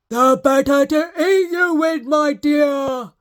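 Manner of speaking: loud snarling shout; wolf snarling before he pounces. Acted. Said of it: "snarled the wolf".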